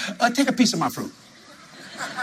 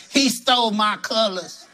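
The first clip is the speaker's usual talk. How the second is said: slow, laughing mock complaint; mock jealous whine; 5 semitones higher, slower than usual. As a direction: pretend to be jealous, joking. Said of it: "I didn't say".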